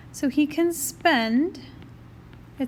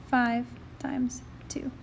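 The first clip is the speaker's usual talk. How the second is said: whispered under the breath; whispering; 5 dB quieter, slower than usual. Real, not acted. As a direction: whisper it to yourself. Muttering the calculation to self.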